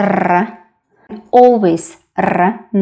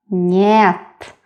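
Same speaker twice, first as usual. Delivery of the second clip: usual speaking voice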